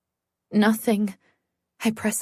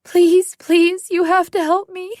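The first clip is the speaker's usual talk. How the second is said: high, loud pleading through sobs; frantic woman begging through tears; sobbing screams; 8 semitones higher, 7 dB louder than usual. Acted. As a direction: beg in panic, screaming and crying. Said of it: "I'm sobbing through my screams".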